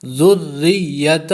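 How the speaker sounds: ذریتہ recited slowly, zal joined to the doubled ra; tajweed recitation. Shown as a demonstration. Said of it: "اس میں بہت لوگ غلطی کرتے ہیں".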